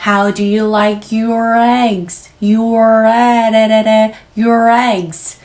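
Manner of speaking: very slow, sounds linked in one breath; slow linking drill. Shown as a demonstration. Teacher models the linked phrase slowly. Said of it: "소리가 묻어서 연음으로 나가죠".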